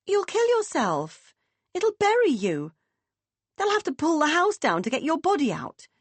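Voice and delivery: high, urgent pleading protest; arguing. Acted. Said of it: "Meg would try to argue".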